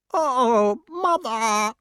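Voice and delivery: high, whiny plea; whining crow. A narrator's reading. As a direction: whine it like a scolded child. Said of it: "whined the crow".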